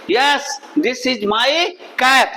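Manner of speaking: "Yes, this is my cap" very slow, tone moving; demonstrated answer intonation. Shown as a demonstration. Teacher showing learners how the tone moves, drawn-out intonation. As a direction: draw out each part of "Yes, this is my cap" to show its tune. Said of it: "अब देखिए मेरा tone किस तरह से चल रहा है".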